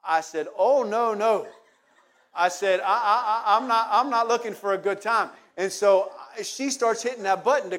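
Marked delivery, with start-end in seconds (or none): 0.6-1.5 alarmed protest. Retold or imitated. Refusing Alice on elevator. 2.8-5.3 flustered protest. Retold or imitated. Stammering refusal to Alice.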